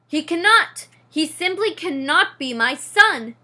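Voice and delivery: loud, anguished protest; despairing outburst. A narrator's reading. Father mouse refuses to accept his son, loud anguished outburst. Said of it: "in despair".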